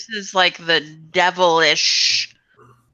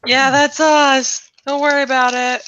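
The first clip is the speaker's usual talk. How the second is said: cocky character voice; 9 semitones higher, 8 dB louder than usual. Acted. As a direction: shrug them off, high and cocky.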